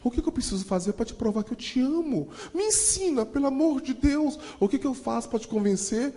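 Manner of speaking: high, pleading voice close to tears; imitating a crying woman's plea, pitched high; tearful plea. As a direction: beg him through tears. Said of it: "Ela começou a chorar e falou assim".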